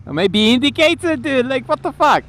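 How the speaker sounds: high, loud exasperated shout at a driver; angry yell. Real, not acted. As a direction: bark it at the car ahead. Driver fails to indicate.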